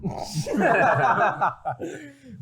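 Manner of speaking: loud high burst of laughter; big high cackling laugh. Real, not acted. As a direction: crack up loudly, high-pitched. Laughing at co-host's ad libs.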